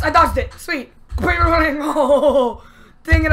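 ordinary talk, nothing marked

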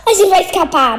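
being chased. Real, not acted. Running from the Pig.

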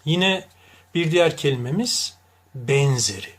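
ordinary talk, nothing marked

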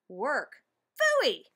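high, loud cry of disgust; scornful outburst. A narrator's reading. Brad objects to working for money, scornful outburst. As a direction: blurt it out in loud protest.

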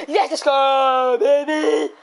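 loud, drawn-out celebration; excited cheer. Real, not acted. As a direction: whoop it out long and loud. Celebrates circle in game.

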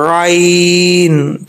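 आइन drawn long from the middle of the throat; ain from mid-throat. Shown as a demonstration. Teacher demonstrating ain from the throat's middle. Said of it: "कैसे?".

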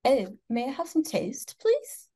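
English accent soaring high on "please"; put-on English accent. Shown as a demonstration. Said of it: "That is my go-to voice".

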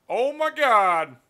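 loud, high, drawn-out exclamation; exaggerated amazement. Real, not acted. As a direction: cry out slowly in huge relief and amazement. Download finally starts moving.

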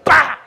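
a hammed-up burst of alarm; imitating a shocked yelp; loud mock bah. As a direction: bark out a startled bah. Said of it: "they go".

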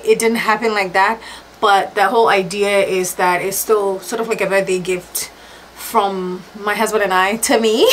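ordinary delivery

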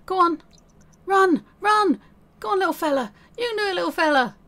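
building from coaxing to a high, loud cheer. Real, not acted. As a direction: urge him on like a race at sports day. Cheering Sim to run.

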